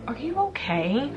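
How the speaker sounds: lightly hammed sarcastic concern; sarcastic are-you-okay. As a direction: tease them as if they've lost it. Mock reply to gossiping groups.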